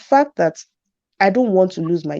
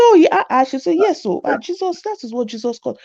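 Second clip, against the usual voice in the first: higher, lightly preachy mother; mock a mother's sermon; mimicking mom preaching; 7 semitones higher than usual.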